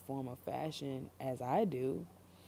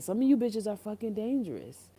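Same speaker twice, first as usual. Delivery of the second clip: loud; 7 semitones higher, 6 dB louder than usual. Real, not acted. Heated warning.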